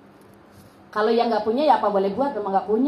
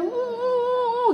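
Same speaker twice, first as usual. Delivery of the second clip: higher, softer mock sobs; mock child sobbing; 9 semitones higher, 4 dB quieter, much slower than usual. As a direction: imitate a child crying. Child crying.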